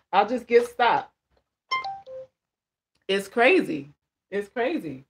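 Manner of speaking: normal talking voice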